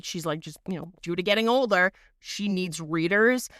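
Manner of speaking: normal talking voice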